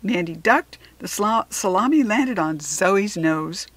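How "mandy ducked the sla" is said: ordinary delivery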